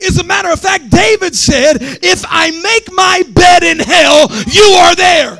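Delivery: loud, chanted whooping sermon; rising shouted sermon cadence; full-force preaching. Real, not acted. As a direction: preach it at the top of your lungs.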